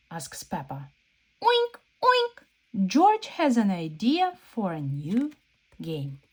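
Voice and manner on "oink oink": high squeaky oink oink; piglet oinks. Acted. George answering Peppa with high oinks.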